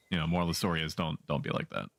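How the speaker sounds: ordinary talk, nothing marked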